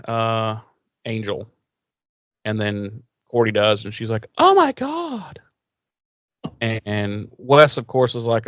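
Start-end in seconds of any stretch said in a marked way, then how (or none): 4.4-5.4 much higher, slow, hammed-up shock; mock gasp. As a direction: squeal it out in stretched disbelief. Cordy sees Angel in the pit.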